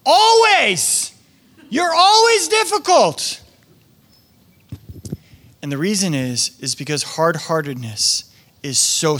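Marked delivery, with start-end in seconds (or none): 0.1-3.4 much higher, louder, fed-up outburst; exasperated family. Retold or imitated. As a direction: cry it out at the difficult one. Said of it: "the people that are in their life are like".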